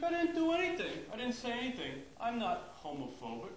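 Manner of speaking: high, defensive dad voice. Retold or imitated. Dad to his kids after the party, high defensive voice. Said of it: "He's like".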